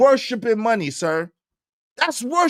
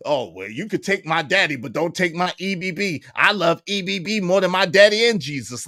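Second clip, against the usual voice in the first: mocking J P; 6 semitones lower than usual. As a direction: lower mocking voice of J P.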